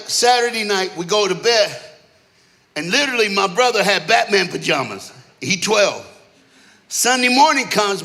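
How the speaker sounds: ordinary talk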